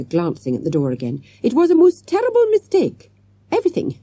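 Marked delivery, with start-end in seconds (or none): 1.4-4.0 high-pitched, insistent protest; hasty addition. A narrator's reading. Miss Parker placating the tackled man, high hasty protest. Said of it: "she added quickly".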